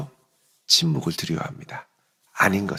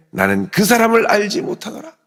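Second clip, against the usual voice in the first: high, loud, emphatic oath; cursing, swearing denial; 12 semitones higher, 7 dB louder than usual. Acted. Peter swearing to the bystanders he is a stranger, vehement denial. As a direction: deny it vehemently. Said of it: "저주하며 맹세하여 이르되".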